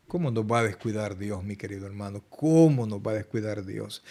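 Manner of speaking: tender appeal. Real, not acted. Pastor comforting a worried brother, soft and tender. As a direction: reassure them softly.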